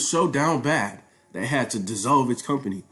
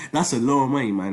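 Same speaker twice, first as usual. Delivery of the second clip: amazed, higher Nigerian-accented exclamation; 3 semitones higher than usual. Real, not acted. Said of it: "Nigerian accent came out there".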